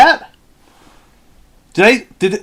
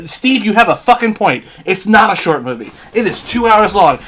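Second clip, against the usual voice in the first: usual speaking voice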